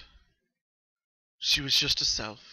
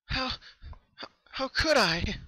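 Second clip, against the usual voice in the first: high, trembling disbelief; shocked; 13 semitones higher, 3 dB louder than usual. Acted. Pinkie Pie aghast at her own nightmare, high and stricken. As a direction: choke out the horror of what she did. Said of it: "Pinkie Pie in shock, tearful".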